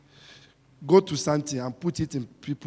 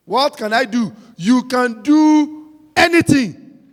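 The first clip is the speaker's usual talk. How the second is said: loud, high shouted preaching; full-force preaching; 9 semitones higher, 11 dB louder, slower than usual. Acted. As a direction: drive it home to the congregation.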